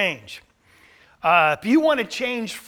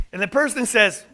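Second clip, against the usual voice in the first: ordinary delivery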